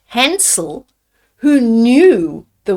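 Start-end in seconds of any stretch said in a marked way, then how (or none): none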